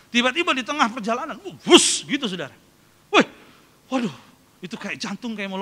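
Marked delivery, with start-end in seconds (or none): 1.7-2.1 loud, sudden "wus" sound effect; whoosh of a dropping plane. Retold or imitated. (tiruan bunyi pesawat anjlok)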